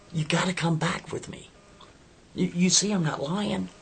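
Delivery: high, loud desperate plea from Kevin; desperate pleading. Acted. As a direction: beg her with everything you've got. Kevin begging her to come.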